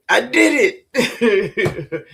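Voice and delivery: laughing through the words; chuckling proud admission. Real, not acted. Laughing at own meal.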